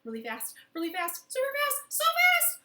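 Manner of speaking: from plain to a high, loud squeal; building excitement. Acted. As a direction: ramp it up to a thrilled shriek. Speeding up the rhyme.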